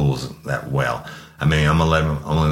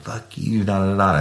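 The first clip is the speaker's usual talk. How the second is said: mocking drunk female; 5 semitones higher than usual. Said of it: "I'm like".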